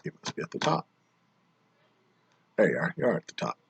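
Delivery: hushed half-voiced remark; whispered aside. Real, not acted. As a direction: whisper it to yourself. Hushed aside while searching rankings.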